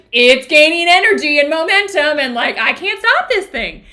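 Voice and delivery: high, loud, hammed-up helplessness; overwhelmed, acted-out exclamation; mock alarm. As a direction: cry out as if it's running away with you. Acting out helplessness at growing anger.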